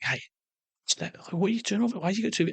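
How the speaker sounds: high, soft mock interrogation of "what's that?"; imitating a suspicious officer, light and high. Officer questioning him at airport.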